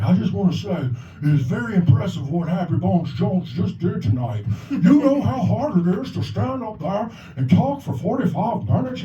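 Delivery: a hint of a creaky old-timer praising; old man voice. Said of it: "his voice is like an old man".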